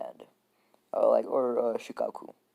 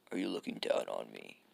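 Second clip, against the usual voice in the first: much lower affronted repeat; low menace; 12 semitones lower, 4 dB quieter than usual. Acted. Kid who feels pitied repeats himself to Naruto, low and menacing. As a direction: drop the challenge deep down. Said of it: "this kid takes it as, like, pity".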